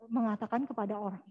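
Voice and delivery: usual speaking voice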